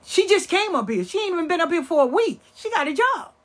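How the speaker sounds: high, loud mock outrage as the neighbor. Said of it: "looking at everybody on the bench".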